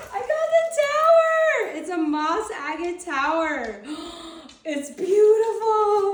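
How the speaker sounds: loud, high, thrilled shrieking; screaming with real joy; shrieking jackpot winner. Real, not acted. Gets the tower she won.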